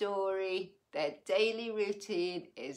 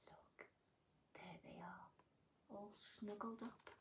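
whisper; 19 dB quieter, slower than usual. Acted. Sleeping children in picture.

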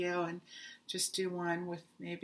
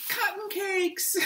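excited; 11 semitones higher, 6 dB louder, slower than usual. Real, not acted. Excited reveal of yarn purchase.

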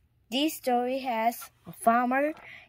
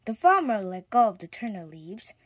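ordinary delivery

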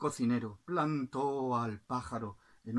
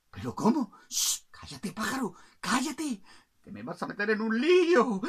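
urgent shushing, rising higher; alarmed cook; 6 semitones higher, 7 dB louder than usual. Acted. Cook silences the bird fearing trouble, flustered hushing.

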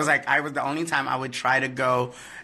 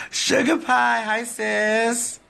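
much higher, bright squealed hello; excited greeting; 10 semitones higher, much slower than usual. Real, not acted. Greeting a fan she calls sis, excited squeal.

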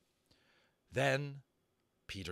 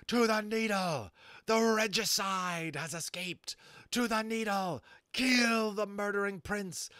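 high, slow, shrieking bird voice; shrieking rage; 9 semitones higher, 5 dB louder, slower than usual. A narrator's reading. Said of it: "He was insane with rage".

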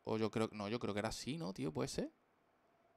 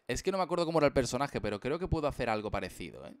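ordinary delivery

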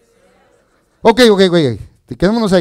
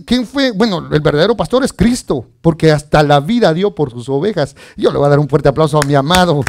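ordinary talk

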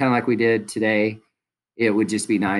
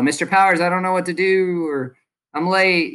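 mock yell; 7 semitones higher than usual. Shown as a demonstration. Teacher demos a late student yelling on arrival. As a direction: blurt it out across the classroom. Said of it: "you're not gonna yell".

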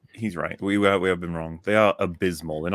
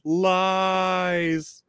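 drawn-out mock villain cry, pitched up; villain's retort; 6 semitones higher than usual. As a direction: stretch the word like a hammy villain. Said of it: "his response is".

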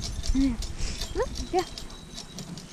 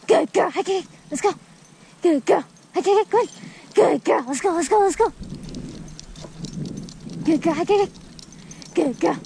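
rally the dog up the hill; excited dog encouragement; cheering on; 3 semitones higher than usual. Real, not acted.

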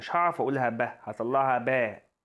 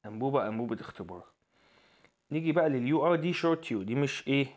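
normal talking voice

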